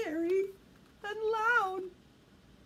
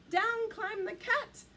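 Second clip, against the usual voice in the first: usual speaking voice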